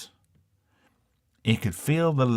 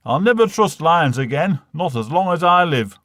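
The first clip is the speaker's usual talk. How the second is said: raised, higher voice of an indignant father; loud vow; 6 semitones higher, 6 dB louder than usual. Acted. Father swears off lions after the lad returns, loud and indignant. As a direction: declare it loudly, a vow for life.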